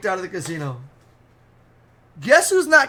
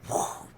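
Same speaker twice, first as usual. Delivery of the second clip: breath-blown whoosh; voiceless whooshing sound effect; imitated splash whoosh; 8 dB quieter than usual. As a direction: blow out a whoosh for flying liquid. Imitating beer being thrown.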